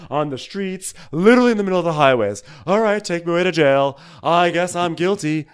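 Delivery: high, loud hammed-up diva voice; mock Luann. Hosts imitating Luann after the crash, hammed-up diva voice. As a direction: ham up the starstruck celebrity, loud and high.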